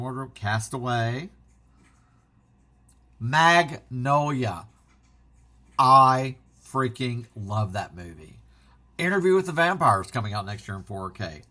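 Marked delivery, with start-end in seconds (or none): 3.2-8.4 slow, loud gushing over a favorite film. Real, not acted. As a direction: lean into the love, drawn out and louder.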